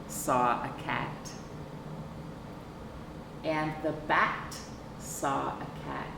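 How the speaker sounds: ordinary delivery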